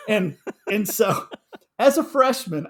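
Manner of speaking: laughing. Real, not acted. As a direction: laugh out the words as you recall it. Laughing while telling.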